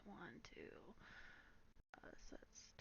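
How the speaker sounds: counting under the breath; whispered counting. Real, not acted.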